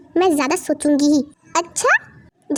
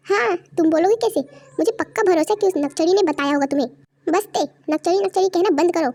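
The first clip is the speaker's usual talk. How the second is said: ordinary talk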